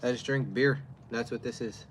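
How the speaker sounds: normal talking voice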